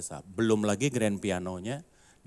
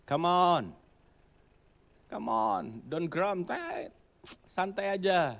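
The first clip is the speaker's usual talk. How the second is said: calming someone down; 8 semitones higher, slower than usual. Retold or imitated. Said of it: "Saya bilang".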